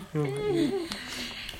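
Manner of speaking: breathless whispered laugh; whispered giggle. Real, not acted. Friends joking, hushed giggle.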